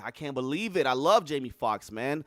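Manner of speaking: ordinary talk, nothing marked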